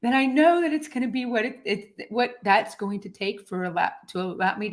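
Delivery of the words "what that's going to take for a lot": ordinary talk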